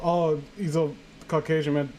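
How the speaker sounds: ordinary talk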